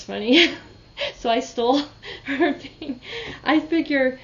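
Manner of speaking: laughing through the words; amused laughing. Real, not acted. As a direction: tell it while breaking into laughter. Amused at her own story.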